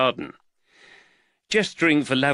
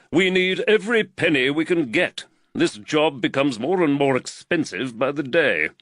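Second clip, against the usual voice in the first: slightly raised, earnest magistrate voice; the worried magistrate, lightly voiced a touch higher; voice of Reed; 6 semitones higher, 4 dB louder than usual. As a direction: magistrate fretting over costs, lightly voiced.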